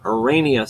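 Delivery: Arania as a-RAY-nia; ay pronunciation. Shown as a demonstration. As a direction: say Arania rhyming with "mania". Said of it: "which one I actually prefer".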